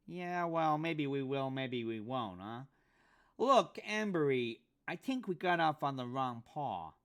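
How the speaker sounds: a weaselly sidekick needling his mark; pinched high voice. Acted. Pinky voiced in dialogue.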